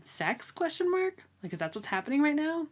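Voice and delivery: reading a shocked aside; confused reader. Retold or imitated. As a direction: act out the baffled reader. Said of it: "written in parentheses".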